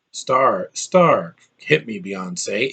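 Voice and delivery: lightly imitated calm Cumberbatch delivery, trailing slow; a hint of a calm British actor. Reviewer imitating Cumberbatch, light calm voice. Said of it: "It's kinda like a calm voice".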